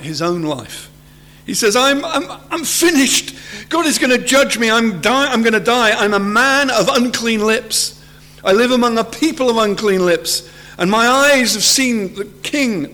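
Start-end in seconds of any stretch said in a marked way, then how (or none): 1.7-12.9 high, urgent cry of doom; acted terror. Preacher as Isaiah, sure he will be judged, acted panic. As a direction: stammer into it, then pour it out in raised pitch. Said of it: "He's absolutely terrified".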